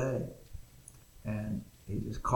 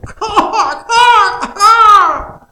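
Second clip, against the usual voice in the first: crow caw; 24 dB louder, much slower than usual. Retold or imitated. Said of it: "All he could do now is just go".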